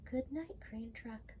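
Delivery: soft whisper trailing off; hushed goodnight. Acted. Reader bidding crane truck goodnight, in a whisper. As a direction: whisper it like a child is asleep.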